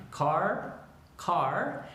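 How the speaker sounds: "Car" with a very long R; drawn-out R. Shown as a demonstration. Teacher showing pronunciation of "car", long drawn-out R. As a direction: hit the C of "car", then hold the R. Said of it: "que sea muy larga".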